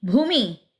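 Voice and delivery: loud angry call of her name; thundering call. A narrator's reading. Anil storming in, thundering at his sister. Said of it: "ಗುಡುಗಿದ ಅನಿಲ".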